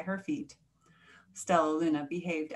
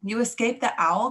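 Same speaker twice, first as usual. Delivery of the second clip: loud, higher cry of surprise; mildly acted joyful disbelief; astonished cry; 4 semitones higher, 7 dB louder than usual. A narrator's reading. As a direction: cry out the question, amazed. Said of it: "cried Stella Luna".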